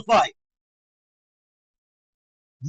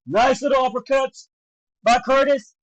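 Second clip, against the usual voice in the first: ordinary delivery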